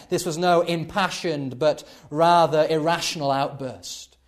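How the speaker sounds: normal talking voice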